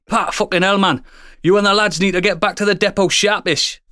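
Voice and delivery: high-pitched rough-and-ready Geordie; postmaster's voicemail in a shaky attempt at Geordie; attempted Geordie accent. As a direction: have a go at a Geordie boss. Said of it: "I'm trying to do a Geordie accent".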